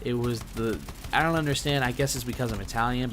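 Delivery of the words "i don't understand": ordinary delivery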